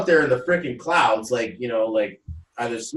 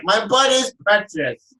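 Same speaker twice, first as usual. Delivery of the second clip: mock-indignant retort; 5 semitones higher, 4 dB louder than usual. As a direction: defend yourself with hammed-up offense. Retorting to being teased.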